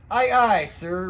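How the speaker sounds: bright cry of aye aye; eager salute. Acted. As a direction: snap a keen sailor's reply. Painter salutes the chief.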